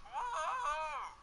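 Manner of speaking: sudden high gasp of alarm; whoa. Real, not acted. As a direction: cry it out as the ground drops away. Reacting to the ledge clip.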